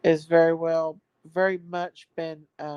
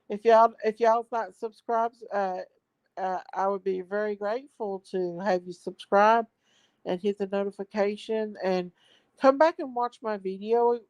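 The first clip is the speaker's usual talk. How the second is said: usual speaking voice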